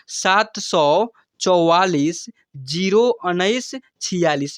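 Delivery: phone number read out slowly, word by word; slow dictation. Real, not acted. Teacher repeats his number for viewers, slow dictation. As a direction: stretch each number for note-taking.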